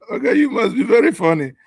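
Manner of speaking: high, loud voice through laughter; amused, laughter spilling into the words; laughing remark. Real, not acted. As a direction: talk while still laughing hard. Laughing at the played clip.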